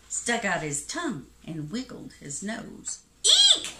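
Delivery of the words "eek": very high, loud squeal; frightened shout. A narrator's reading. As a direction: shriek as if startled by a mouse. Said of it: "shouted the elephant".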